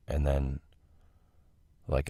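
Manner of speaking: normal talking voice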